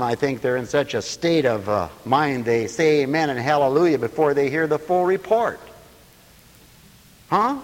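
usual speaking voice

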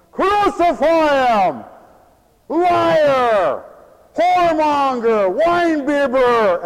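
loud, high, drawn-out jeering shouts; yelling mob. Acted. Preacher acting the crowd shouting at Jesus, yelling. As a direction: hurl each accusation like an angry mob. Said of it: "People yelling and shouting".

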